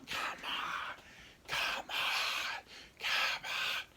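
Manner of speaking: urgent whispered coaching; penis whispering. Acted. His penis urging him on from third base, whispered. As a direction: hiss it like a sideline coach. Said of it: "my penis is, like, on third base".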